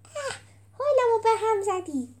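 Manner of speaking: high-pitched groan of disgust; exasperated, high-pitched "yuck". A narrator's reading. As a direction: spit out your revulsion. Character shoves him aside, disgusted.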